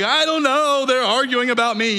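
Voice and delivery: high, loud frantic blurt; panicked answer. Retold or imitated. Answering the guide amid the argument, high and frantic. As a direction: blurt it out desperate and overwhelmed.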